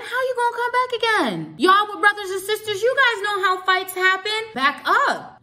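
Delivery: high-pitched, agitated scolding; re-enacted confrontation, worked up and high; heated telling-off. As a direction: warn him off, voice pitched right up.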